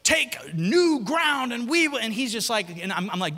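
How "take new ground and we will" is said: dad preaching. Retold or imitated. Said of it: "And he said".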